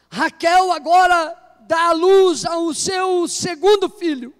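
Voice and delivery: loud, slow, fervent scripture read; preaching at full force. Acted. Preacher proclaims Rachel's birth to the congregation, loud and slow. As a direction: thunder the verse out over the church.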